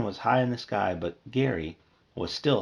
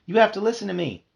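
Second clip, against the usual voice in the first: high, louder urgent plea; 10 semitones higher, 6 dB louder than usual. Acted. Bee pleading into his sister's ear, urgent. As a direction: make her listen.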